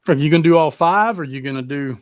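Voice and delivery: ordinary talk, nothing marked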